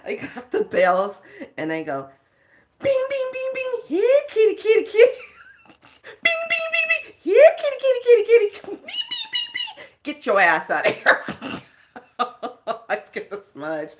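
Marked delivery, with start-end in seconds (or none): 2.8-9.9 very high, loud chanted calls; high sing-song calling. Retold or imitated. Ringing bells and calling kitty in a high sing-song. 10.0-11.2 loud, flat command; loud order. Retold or imitated. Ordering the spirit out, loud.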